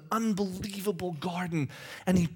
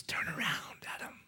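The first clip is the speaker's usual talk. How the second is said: hushed whisper, softer; 13 dB quieter than usual. Acted. God whispers to Adam to turn around. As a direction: breathe it like a secret.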